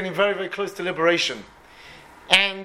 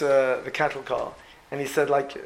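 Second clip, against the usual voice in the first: normal talking voice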